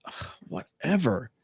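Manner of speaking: slow, exasperated groan and brush-off; fed-up dismissal. Retold or imitated. Patient giving in to the kid's order, fed up. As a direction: give in with a drawn-out groan.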